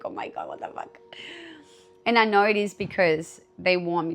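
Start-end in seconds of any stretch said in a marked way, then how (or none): 0.0-1.0 breathy whispered shock, hushed and low. Retold or imitated. To herself after learning she did it all wrong, whispering. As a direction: gasp it under your breath like a private panic. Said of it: "I'm like".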